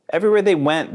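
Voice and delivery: ordinary delivery, nothing marked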